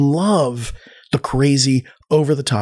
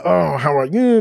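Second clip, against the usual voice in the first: higher, mock French greeting; over-the-top French accent; 4 semitones higher than usual. Pretend royal greeting a diner, mock French accent. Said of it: "speak in over-the-top, uh, French accent".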